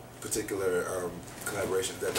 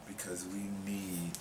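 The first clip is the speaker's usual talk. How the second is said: whispering; 9 dB quieter, much slower than usual. Acted. Whispered plea.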